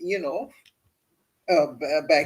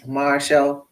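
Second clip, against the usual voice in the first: usual speaking voice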